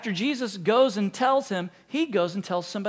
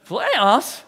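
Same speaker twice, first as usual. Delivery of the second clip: incredulous press-conference rant, higher and louder; Jim Mora rant; 6 semitones higher, 6 dB louder than usual. Retold or imitated. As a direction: rant in disbelief like the coach. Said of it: "Sounds like Jim Mora, playoffs".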